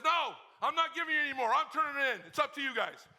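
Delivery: loud, high called reply; coach calling off. Retold or imitated. Coach shouting back that practice is over. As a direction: shout across the field. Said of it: "Coach says".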